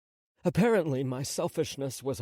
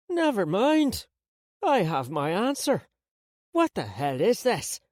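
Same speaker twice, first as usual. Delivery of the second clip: grandmother voice; 7 semitones higher, 5 dB louder than usual. A narrator's reading. Grandmother tastes liquor.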